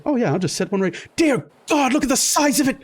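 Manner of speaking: sudden high alarm at the size of it; startled Lamont. Acted.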